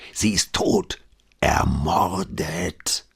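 hushed, savouring whisper, ermordet drawn out slowly; gossiping newsagent whispering the murder news, relishing it. Acted. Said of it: "andächtig das letzte Wort liebkosend".

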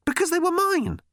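high-pitched indignant retort; cross. A narrator's reading. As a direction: protest crossly, pitch shooting up. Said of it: "said Ford crossly".

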